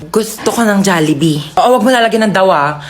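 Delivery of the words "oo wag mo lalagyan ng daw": usual speaking voice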